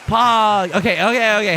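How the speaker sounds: loud excited cheer. Real, not acted. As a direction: pop it out with glee. Excited exclamation.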